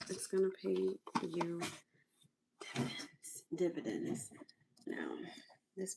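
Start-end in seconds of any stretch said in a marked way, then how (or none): 2.7-3.4 whisper. Real, not acted. Whispered before plain repeat.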